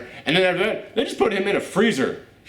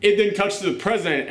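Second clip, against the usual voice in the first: ordinary delivery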